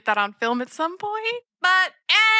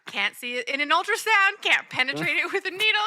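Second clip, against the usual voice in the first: mock Carlisle; 6 semitones higher than usual. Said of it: "Carlisle's like".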